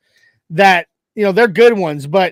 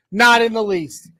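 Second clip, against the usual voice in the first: normal talking voice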